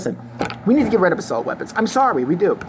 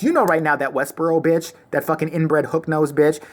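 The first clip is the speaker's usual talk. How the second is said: ordinary talk, nothing marked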